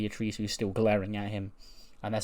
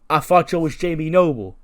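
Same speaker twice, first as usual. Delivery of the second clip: higher, louder character voice; put-on confused voice; 5 semitones higher, 9 dB louder than usual. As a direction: play it dopey and loud. Truth's confused reply.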